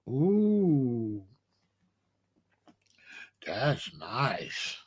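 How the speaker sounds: a near-whispered awe at the velvet; hushed impressed mother. Retold or imitated. Mother admiring, retold again.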